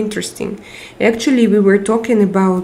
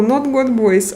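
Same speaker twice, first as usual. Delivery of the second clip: normal talking voice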